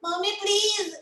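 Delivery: put-on high, pleading little-child voice; sweet pleading to mom. Shown as a demonstration. Talking to her mom, sweetly pleading. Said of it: "like how I talk to my mom".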